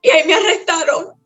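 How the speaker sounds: más aguda, al borde del llanto real; cuéntalo con la voz quebrada por el llanto; voz llorosa. Real, not acted. Llorosa al recordar su arresto. (llorosa de verdad, tono más alto)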